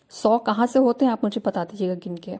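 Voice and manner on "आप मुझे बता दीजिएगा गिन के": normal talking voice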